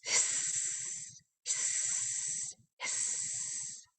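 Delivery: soft whispered hiss; snake hiss. Shown as a demonstration. Showing the kids a snake's soft hiss. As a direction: hiss like a snake, breath only. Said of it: "like a snake".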